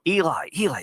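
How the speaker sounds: high, hushed-urgent calling out; lightly imitated crew member calling; off-camera call. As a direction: get his attention from off camera. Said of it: "a guy off camera go".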